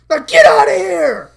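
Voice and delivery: very loud, high shout; angry yell. Acted. Eddie chasing Marie away, loud angry yell. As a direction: order her off at full volume.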